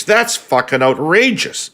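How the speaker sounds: high-pitched mock outrage, shouted; ordinary Canadian voice. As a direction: play an indignant everyday voter. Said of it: "ordinary Canadian voice while shouting these words".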